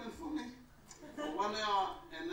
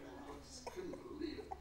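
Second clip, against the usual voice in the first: whisper; 9 semitones higher, 7 dB quieter than usual. Real, not acted. Whispered amazement.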